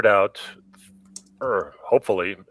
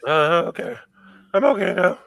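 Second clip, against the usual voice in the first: higher, strained voice after choking; 9 semitones higher than usual. Real, not acted. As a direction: still recovering from choking. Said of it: "You okay, sonny boy?".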